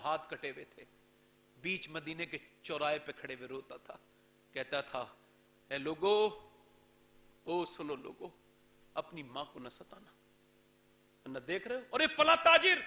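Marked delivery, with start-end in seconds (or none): 1.6-11.8 low, soft grieving plea; mournful lament. Retold or imitated. Crippled son weeping in the Medina square, soft mournful plea. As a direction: lament like a ruined man.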